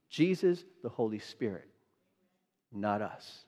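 usual speaking voice

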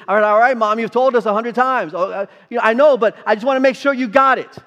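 mock groan of children told too many times; loud whiny all right mom; exasperated kids. Kids answering nagging mom.

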